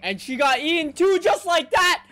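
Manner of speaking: high, loud disbelieving shout; can't believe it happened; shocked yell. Real, not acted. Anime reactor shouting in disbelief at the screen. Shocked she got eaten.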